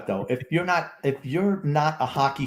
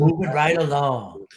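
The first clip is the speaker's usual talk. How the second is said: catchphrase voice; 3 semitones higher, 3 dB louder, slower than usual. Pretend. Catchphrase sung out by co-host.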